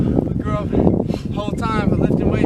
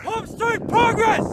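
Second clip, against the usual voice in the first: barked sound off; 13 semitones higher, slower than usual. Shown as a demonstration. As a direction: sound off like a drill recruit. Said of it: "Sound off like you got a pair".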